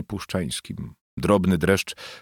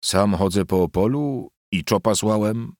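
usual speaking voice

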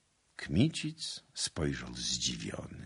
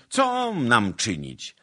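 slow, raised lament; complaining; 7 semitones higher, 8 dB louder, slower than usual. A narrator's reading. Soldiers pleading their hardship to the commander, complaining. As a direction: drag the question out loudly. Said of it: "z narzekaniem i skargami".

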